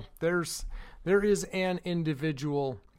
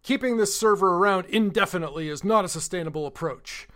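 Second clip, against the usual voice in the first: louder, mock-officious reading of his email; sending up a hostile manager's email, louder; mocking pompous boss; 2 semitones higher, 7 dB louder, faster than usual. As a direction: ham up the pompous demand, then trail into blah blah. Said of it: "emails and says".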